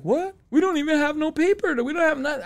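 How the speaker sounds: a lawyer caught off guard; surprised lawyer. Retold or imitated.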